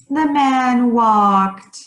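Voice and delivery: drawn out. Shown as a demonstration. Teacher demonstrates how the man walked, drawn out slowly. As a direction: stretch each word out long and slow. Acting out a walking example.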